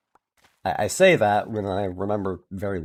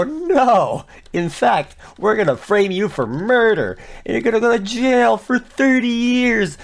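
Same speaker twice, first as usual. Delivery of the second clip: villainous mock threat; 11 semitones higher, slower than usual. Staff threatening absurdly.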